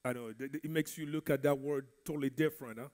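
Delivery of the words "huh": ordinary talk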